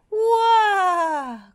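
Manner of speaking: long, high, loud "wah" cry; cartoon yelp held high; tripping wail. A narrator's reading. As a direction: wail as if tumbling over. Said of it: "cried the dragon as he tripped".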